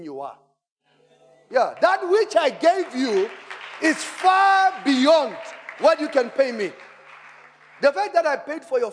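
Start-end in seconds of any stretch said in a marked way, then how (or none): 1.5-8.9 preaching shout. Real, not acted. Pastor preaching climax.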